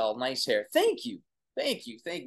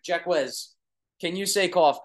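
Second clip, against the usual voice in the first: normal talking voice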